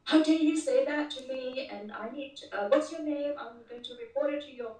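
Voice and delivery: retold irate caller demanding a name; angry caller. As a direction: replay the caller's huffy threat.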